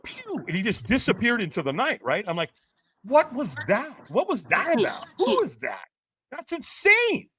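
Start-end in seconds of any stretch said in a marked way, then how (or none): 0.0-0.4 high, quiet "pew" zip; whoosh sound effect. Retold or imitated. Imitating a guy vanishing into the night, quick high "pew". 3.0-7.3 high-pitched incredulous questions; retold bafflement. Reacting to a stranger's strange advice, retold disbelief.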